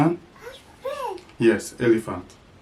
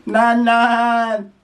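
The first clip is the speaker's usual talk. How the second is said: goat calling; 11 semitones higher, 4 dB louder than usual. Pretend. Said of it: "That's how your goat gonna call you".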